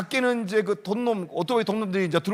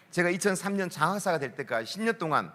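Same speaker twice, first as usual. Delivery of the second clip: normal talking voice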